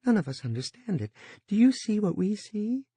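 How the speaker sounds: high, agitated woman's voice. A narrator's reading. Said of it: "Edie Dorn said agitatedly".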